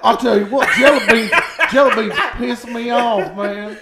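high, loud hammed-up rant; laughing parody of an outraged opinion; mock hot take. Teasing co-host about his jelly bean hatred, mock outraged rant. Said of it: "watch out for this hot take".

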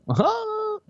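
high shrieking mock laughter; shrieking kid laugh. Kids jeering at the sweaty costume, high shrieking laugh. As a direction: cackle like a taunting child.

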